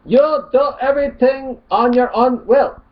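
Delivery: high, loud put-on Arabic accent; mock Arabic accent. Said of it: "Mock Arabic accent here".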